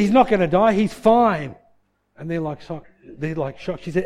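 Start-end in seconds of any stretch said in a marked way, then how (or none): 0.0-1.5 loud, high, certain contradiction; forceful insistence. Retold or imitated. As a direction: overrule the panic, imitating a firm mum. Joy overrules the panicking relatives.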